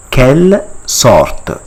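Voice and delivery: "Quelle sorte" slowly as kel sort, r sounded; French pronunciation. Shown as a demonstration. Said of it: "it is called".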